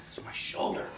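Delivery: hushed mock-feeble voice; weak, spent reply. Storyteller voices exhausted man answering the doctor, faint whisper. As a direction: barely get the words out.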